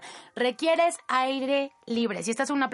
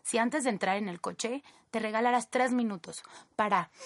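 usual speaking voice

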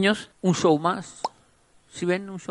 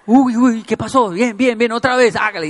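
loud, higher hyping cheer; excited shout; 5 semitones higher, 7 dB louder than usual. Retold or imitated. Spinning his friend in the washer, loudly cheering him on.

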